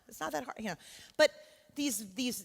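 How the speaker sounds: normal talking voice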